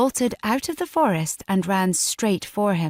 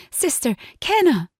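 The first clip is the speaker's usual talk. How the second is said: high, breathless shout of a name; winded girl clinging to a stranger, crying out; gasping cry; 9 semitones higher, 3 dB louder than usual. A narrator's reading. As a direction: fight for air between the words. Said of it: "between gasps of air".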